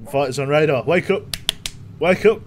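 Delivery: loud, high warning to wake up; loud high wake-up call; alarm call. Real, not acted. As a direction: snap yourself awake as fighters show up. Alerting himself to fighters.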